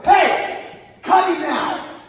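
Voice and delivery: loud, high furious shout; shouted order. Retold or imitated. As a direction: yell it with everything. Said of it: "I go".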